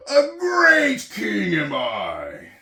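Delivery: loud, high, proud king's voice; boastful turtle king. Acted. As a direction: crow like a vain little tyrant. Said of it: "saying over and over".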